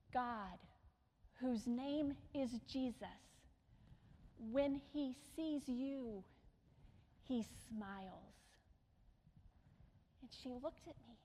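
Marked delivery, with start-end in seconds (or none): 0.1-8.4 tender and soft. Retold or imitated. Tender message spoken to the woman.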